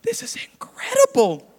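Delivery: breathless high whisper of wonder; hushed amazed whisper on the plane; awed whisper. Retold or imitated. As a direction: whisper it in awe. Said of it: "And I'm like".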